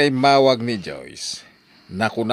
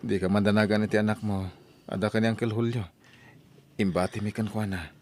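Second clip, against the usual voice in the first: naalumamay nga panangliwliwa; 7 dB quieter than usual. Acted. Comforting sick sister softly.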